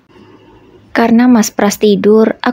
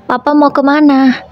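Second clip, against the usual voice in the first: put-on higher-pitched little girl calling to her papa; child calling out; 6 semitones higher than usual. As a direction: call out after papa as a small girl. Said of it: "teriak Dewi". (anak kecil memanggil papanya dengan suara tinggi)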